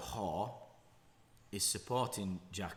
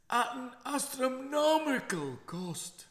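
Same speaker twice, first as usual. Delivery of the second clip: much higher, louder, slow mock Scottish; over-the-top Scottish councillor, drawn out and booming; Scottish accent; 8 dB louder, slower than usual. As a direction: drag out the words in the hammed-up Scottish voice. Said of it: "that was my Scottish accent".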